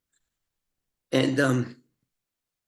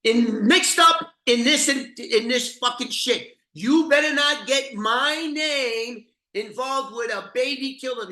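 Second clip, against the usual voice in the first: mock gangster rage; 8 semitones higher, 3 dB louder than usual. Mad Dog threatening Brian to keep his name out, voiced as higher angry rage. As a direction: spit it out like an enraged mobster. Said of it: "he goes".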